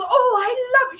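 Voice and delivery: mock delighted squeal; excited buyer. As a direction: gush like a thrilled buyer. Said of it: "She was so excited".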